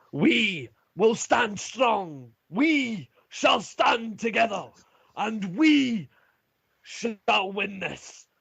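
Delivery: slow, high battle-speech shout; loud commander rousing the troops. Acted. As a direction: shout a rallying vow to the army, stretching the words. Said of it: "continues to shout".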